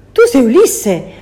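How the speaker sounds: loud, high shout; cry of recognition. Acted. Circe crying out as she recognises Ulysses. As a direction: call out his name in shock. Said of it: "gridò Circe".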